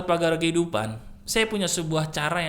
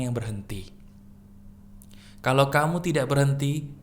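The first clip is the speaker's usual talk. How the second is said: sincere closing; 6 semitones lower, 3 dB quieter than usual. Acted.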